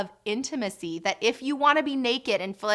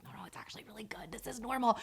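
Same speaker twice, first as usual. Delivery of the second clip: soothing whisper; 10 dB quieter, faster than usual. Retold or imitated. Doula quietly calming the partner.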